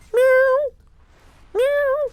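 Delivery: very high squeaky meow, twice; cat meow imitation. Streamer imitating a cat in the cockpit, high meows. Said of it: "all you hear is meow".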